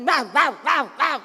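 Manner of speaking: loud, staccato imitated barks; mock guard-dog woofs; dog barking. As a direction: bark like a fierce dog. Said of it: "Kayak anjing galak".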